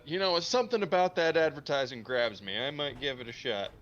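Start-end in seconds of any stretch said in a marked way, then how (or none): 0.1-2.6 commercial testimonial. Pretend. Mock testimonial shopper.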